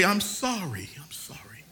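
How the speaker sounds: a hushed, humble sorry; whispered apology. Pretend. Preacher whispering a broken person's apology. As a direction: whisper it, fading to almost nothing. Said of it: "You say".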